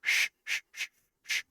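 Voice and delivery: soft, repeated shushing; hushing. Real, not acted. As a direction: shush the others to watch. Hushing the others for a scene.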